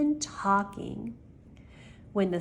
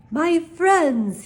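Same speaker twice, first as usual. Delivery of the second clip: high, loud showman's greeting; clear, keen announcement; 8 semitones higher, 8 dB louder than usual. A narrator's reading. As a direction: announce yourself like a salesman. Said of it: "he announced in a voice clear and keen".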